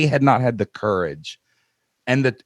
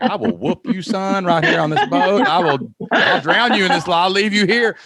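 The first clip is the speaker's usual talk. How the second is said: mock threat; 9 semitones higher than usual. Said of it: "And I'm like".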